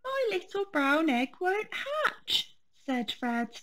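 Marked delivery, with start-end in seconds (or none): 0.0-2.5 high, sulky whine of a tired cub; high sulky cub whining to Mummy; tired and grumpy. Acted. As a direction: complain like a worn-out little bear. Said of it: "feeling very tired and grumpy".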